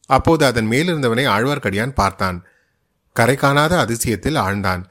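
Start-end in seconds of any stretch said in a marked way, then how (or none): none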